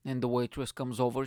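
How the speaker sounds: normal talking voice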